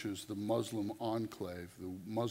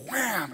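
higher, louder mock "wham"; fist-slam sound; 10 semitones higher, 6 dB louder than usual. Preacher retelling the inmate's fist slam, mock bang. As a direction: make the bang of a fist on the table. She slams her fist down and goes.

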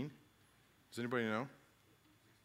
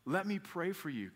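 put-on young son; 6 semitones higher than usual. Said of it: "he's like".

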